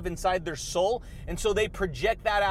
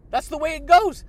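hyped catchphrase; 7 semitones higher, 8 dB louder than usual. Real, not acted. Catchphrase shout-out to viewers.

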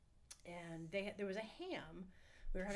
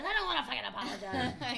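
put-on loud, higher bratty kid yelling back; defiant sugar-fueled kid; 4 semitones higher, 7 dB louder than usual. Kid shouting back at his scolding mother, defiant and loud. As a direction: snap back like a kid high on candy. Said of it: "I'm all jacked up on sugar".

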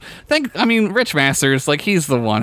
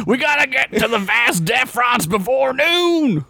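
drill sergeant; 11 semitones higher than usual. Retold or imitated. Sperm drill sergeant scene.

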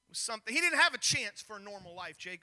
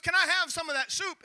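serious plea; 6 semitones higher, 5 dB louder than usual. Retold or imitated. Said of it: "And Esau is very serious".

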